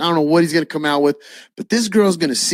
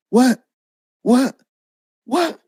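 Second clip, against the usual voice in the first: short, high questions climbing each time; retold bewildered shock; stunned disbelief; 10 semitones higher, slower than usual. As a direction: ask in rising, squeaky disbelief. Stunned at her reaction.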